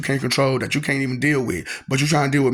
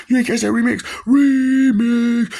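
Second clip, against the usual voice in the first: high, loud hype shout of remix; hyped remix call; 10 semitones higher, 5 dB louder, slower than usual. Real, not acted. Hyping the listeners on the remix. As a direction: call it out like a DJ drop.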